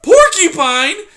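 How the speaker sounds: high, loud, slow horrified mouse; horrified gasp of a word. Acted. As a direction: squeal it in terror. Poppy voiced in story.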